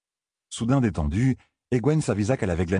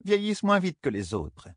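higher, light elder woman voice; wise woman voice; 8 semitones higher than usual. Acted. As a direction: tell it like a knowing elder. Amis tells of Mora.

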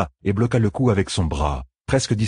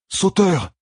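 high, urgent yell of a name; roaring cry; 13 semitones higher, 3 dB louder than usual. A narrator's reading. Hero fighting free to save his wolf, roaring cry. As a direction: roar the name out, pitch leaping up. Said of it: "En rugissant".

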